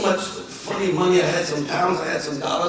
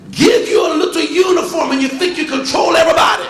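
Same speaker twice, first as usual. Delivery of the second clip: loud, high furious yell; angry yelling; 9 semitones higher, 7 dB louder than usual. Retold or imitated. Traveller yelling at the uniformed officer, loud and high. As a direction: tear into the officer. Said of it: "I was yelling at the guy".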